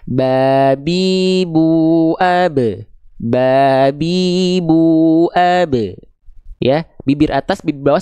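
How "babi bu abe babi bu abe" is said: very slow B demonstration, vowels stretched; B drill, drawn out. Shown as a demonstration. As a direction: chant ba-bi-bu-ab with long held vowels. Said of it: "Sebagaimana kita melafalkan huruf B". (pelafalan huruf B dipanjangkan)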